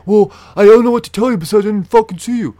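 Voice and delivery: loud, high, rapid comeback; mimicked angry retort. Brother arguing with the officer, loud indignant mimicry. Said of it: "he's starting to fucking".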